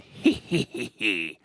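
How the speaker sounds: sinister villain's he-he laugh; a creepy villain chuckle from the dark; evil giggle. Acted. Villain laughing as he emerges from the hallway.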